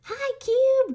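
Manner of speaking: very high, loud squeaky robot yell; yelled greeting. Acted. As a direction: shriek it out like a hyper little robot. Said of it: "Gary yelled".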